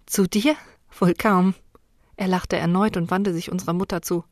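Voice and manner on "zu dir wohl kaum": scornful, louder retort; dismissive. Acted. Sister rejecting her brother, louder and contemptuous. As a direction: throw it back at him with contempt. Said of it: "Abwertend sah ich zu ihm auf".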